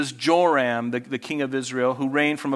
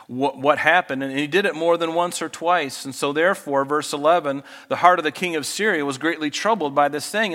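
ordinary talk

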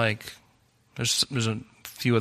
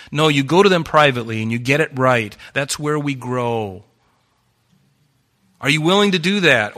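higher and louder rebuke; fiery preaching; 4 semitones higher, 5 dB louder than usual. Acted. Pastor rebuking the congregation for hiding like cowards. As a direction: challenge them, full force.

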